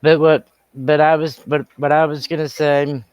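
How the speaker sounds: stumbling over the opening words; tripping over repeated false starts; real stuttering start. Real, not acted. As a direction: keep restarting the sentence as you search for it. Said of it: "excuse me for stuttering".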